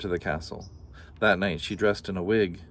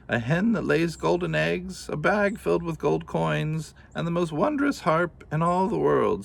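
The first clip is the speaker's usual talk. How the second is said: put-on higher old woman's voice telling of treasures; 8 semitones higher than usual. Old woman explains the task.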